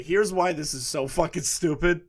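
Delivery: usual speaking voice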